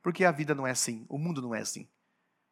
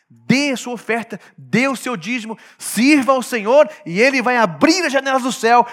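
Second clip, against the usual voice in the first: louder, higher, hammed-up pitch for offerings; mock prosperity preacher; 6 semitones higher, 10 dB louder than usual. Preacher parodies churches selling blessings, mocking loud pitch.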